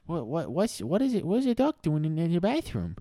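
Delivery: put-on high confused little boy; confused kid. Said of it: "He's like".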